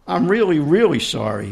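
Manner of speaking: pleading husband, lightly voiced; implored. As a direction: beg for it, a hint of pleading. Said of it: "he implored".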